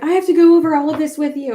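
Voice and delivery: fast, loud eager retelling; excited. Retold or imitated. Texting Tony about the new clothes, fast loud eager. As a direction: rush it out, bursting to share. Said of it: "and I was like".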